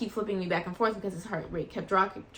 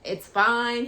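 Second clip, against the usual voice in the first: high, gentle reassurance; reassuring; 6 semitones higher, much slower than usual. Retold or imitated. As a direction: soothe the embarrassed doctor. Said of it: "I'm like".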